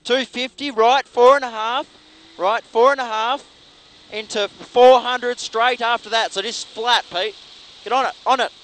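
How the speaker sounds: loud, urgent co-driver calls; rally pace notes. Real, not acted. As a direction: bark out corner calls over the engine.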